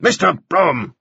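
curt judge's rebuke; irritated judge snaps at the prosecutor; snapped. Acted. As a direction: cut him off sharply. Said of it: "snapped the justice at once".

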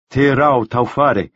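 slow, grave self-naming; measured reply. A narrator's reading. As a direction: give your name deliberately. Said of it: "name said".

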